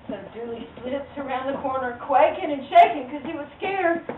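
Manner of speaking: usual speaking voice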